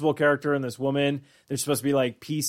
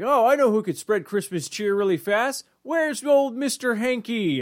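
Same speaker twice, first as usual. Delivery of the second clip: jolly Santa; 8 semitones higher than usual. Retold or imitated. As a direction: play a cheery Santa. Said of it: "he's all like".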